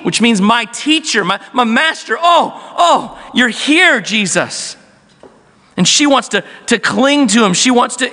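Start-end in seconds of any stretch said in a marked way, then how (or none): 0.0-4.8 calling out in joy, with short cries at the peak; joyful calling out. Retold or imitated. Mary recognising the risen Jesus, calling out in joy. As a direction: cry out her teacher's name in overjoyed recognition. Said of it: "She immediately calls out".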